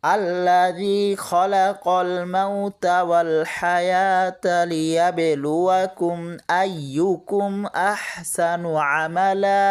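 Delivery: slow, drawn-out melodic Quran chant; Quranic recitation. Shown as a demonstration. Teacher reciting the verse for students, slow melodic tilawat. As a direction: recite it slowly like tilawat, stretching each vowel.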